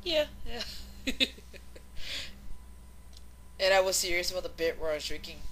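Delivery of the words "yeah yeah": ordinary talk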